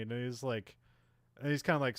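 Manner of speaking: ordinary delivery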